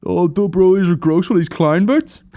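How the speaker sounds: high, loud sneering voice; high loud sneering troll; mock commenter. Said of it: "And I was like".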